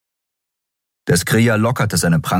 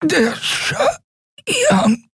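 high, strained choking voice; strangled and straining; choked gasp. A narrator's reading. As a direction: force the words out while being strangled. Choked captive forcing out words.

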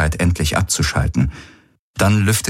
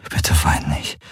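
leise ins Ohr geflüstert; flüstere es ihr tröstend zu; flüsternd; 3 semitones lower than usual. A narrator's reading. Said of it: "flüsterte er ihr ins Ohr". (Galen tröstet Ki: geflüstert)

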